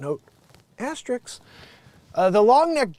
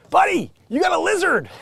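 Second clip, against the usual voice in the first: calling out; 12 semitones higher, 5 dB louder than usual. Acted. Calling out to man in photo.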